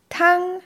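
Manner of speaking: Tang held level and high; first tone. Shown as a demonstration. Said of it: "Noch einmal". (Tang im ersten Ton, hoch und gleichbleibend)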